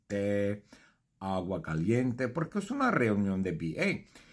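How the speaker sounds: ordinary delivery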